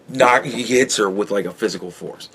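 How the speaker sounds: ordinary talk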